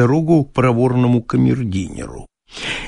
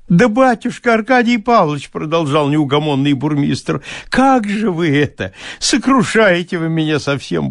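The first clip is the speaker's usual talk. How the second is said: higher, fawning peasant fuss; 4 semitones higher than usual. Acted. Bailiff frets to his master, high and fawning. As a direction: plead and fret like a servile bailiff.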